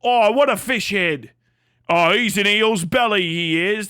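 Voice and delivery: loud, hammed-up British insult; exaggerated British exclaiming; mock British accent. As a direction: caricature a Brit trading silly insults. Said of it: "Isn't that what you people say?".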